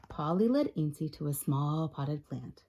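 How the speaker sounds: usual speaking voice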